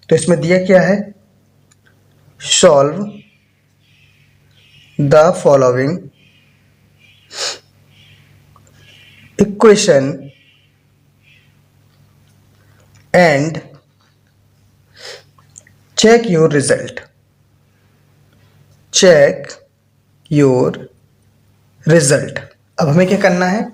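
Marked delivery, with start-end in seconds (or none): 2.6-13.6 long pauses between words; word-by-word dictation. Real, not acted. Maths teacher dictating the exercise heading, word-by-word dictation. 18.9-22.4 "Check", "your", "result", each set apart by a long pause; word-by-word dictation. Real, not acted. Maths teacher repeating "Check your result" for copying, word-by-word dictation. Repeats phrase slowly while writing it.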